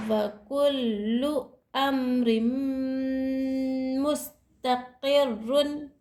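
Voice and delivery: slow, drawn-out chanting of the words; slow Quranic recitation. Shown as a demonstration. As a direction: recite each word long and steady. Recites the verse slowly. (धीरे खींचकर तिलावत)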